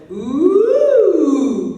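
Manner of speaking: loud, high ooh held without a break; no-flip ooh demo. Shown as a demonstration. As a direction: keep the ooh connected with no flip. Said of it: "No flip".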